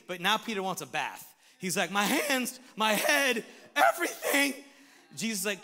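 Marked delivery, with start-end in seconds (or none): 1.9-4.5 loud, climbing, comic eagerness; Peter's over-eagerness. Acted. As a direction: build higher and louder with each item. Peter eagerly wants a whole bath.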